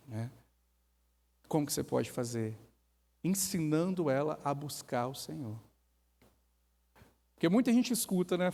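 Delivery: normal talking voice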